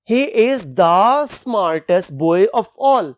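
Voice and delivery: slow, stretched example sentence; reading an example. Shown as a demonstration. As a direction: read the example slowly to the class. Example read slowly. (धीमा उदाहरण वाक्य)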